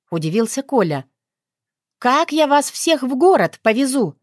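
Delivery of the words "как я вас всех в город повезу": raised voice. A narrator's reading. Veronika shouts at friends about the ride. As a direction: raise your voice in frustration. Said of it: "повысила голос девушка".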